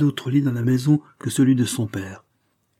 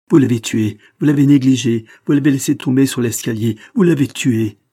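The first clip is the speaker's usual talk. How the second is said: loud, raised-voice outburst; furious accusation; 3 semitones higher, 7 dB louder than usual. A narrator's reading. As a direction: hurl the blame at her, loudly. Distraught mother accuses Suzanne.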